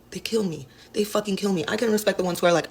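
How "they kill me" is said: voiceless, exasperated whisper after a sigh; weary sigh. Real, not acted. Dancer complaining about customers who won't buy dances. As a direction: sigh it out, worn down.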